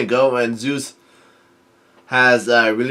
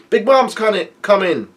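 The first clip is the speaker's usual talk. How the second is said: much higher, panicked alarm call; startled lookout crying out; surprised yell; 8 semitones higher than usual. A narrator's reading. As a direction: warn everyone in a startled squeal. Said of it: "surprised and he yells out".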